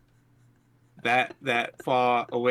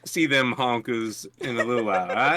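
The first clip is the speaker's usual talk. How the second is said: put-on teasing buddy in a Southern accent. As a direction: play it as a good-ol'-boy Southern. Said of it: "That was a Southern accent".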